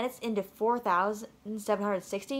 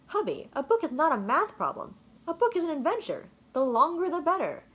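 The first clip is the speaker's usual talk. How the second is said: higher, enthusiastic motherly voice; mom's voice; 7 semitones higher than usual. Acted. Mom encourages son to love reading, higher and bright. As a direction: sell the book like an adventure.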